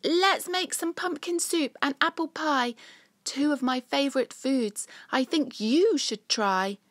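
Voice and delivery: high, loud little critter voice; chirpy little Cyril; small squirrel voice. Acted. Cyril talks to the dragon.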